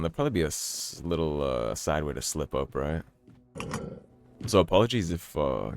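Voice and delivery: ordinary talk, nothing marked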